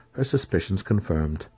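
plain reading voice

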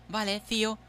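mock Spaniard catchphrase; Spanish accent. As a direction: put on an exaggerated Spain accent. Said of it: "los acentos de los españoles".

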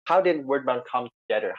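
ordinary talk, nothing marked

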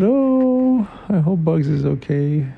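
put-on high, squeaky little boy's worried "Oh"; anxious kid's voice rising on "Oh"; Tail, worried. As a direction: fret for Bugs in a high child's voice. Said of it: "And Tail said".

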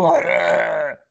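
creature-like roaring "argh"; growled "argh". Acted. Co-host imitating feral Ewoks to his friends, mock growl. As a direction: imitate an Ewok's savage cry. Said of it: "they still go".

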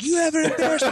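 very high mock-furious screech; enraged mom. Mom grabbing and beating her kid, imitated screech. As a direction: scold in an exaggerated high mom voice.

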